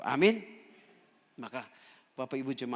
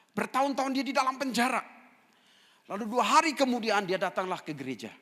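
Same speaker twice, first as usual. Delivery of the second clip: raised, high-pitched preacher storytelling; forceful preaching; 10 semitones higher, 7 dB louder than usual. Real, not acted. Preacher stressing a man's long years in prison, forceful. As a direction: preach it loud, easing down at the end.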